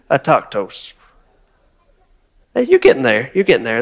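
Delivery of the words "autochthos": "Autochthos" said low and dropping, with disdain. Shown as a demonstration. Pastor teaching congregation the word, with disdain. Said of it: "shake your head a little bit too".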